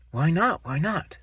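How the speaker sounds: high eager little monster voice; a curious young creature pestering his dad; Gruffalo's child. Acted.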